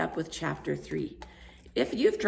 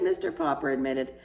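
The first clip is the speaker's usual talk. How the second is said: ordinary delivery, nothing marked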